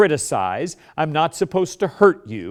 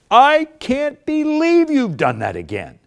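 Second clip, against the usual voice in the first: higher, louder, slow exasperated scold; 11 semitones higher, 5 dB louder than usual. Shown as a demonstration. Spouse scolding spouse, loud exasperated demo. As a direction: blow up at them. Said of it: "easy for us to get loud when we get negative".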